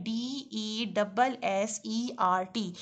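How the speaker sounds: letters spelled out slowly; slow letter-by-letter spelling. Shown as a demonstration. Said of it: "उसके spelling थोड़े different हैं".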